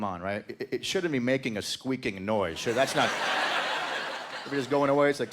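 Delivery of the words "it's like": ordinary talk, nothing marked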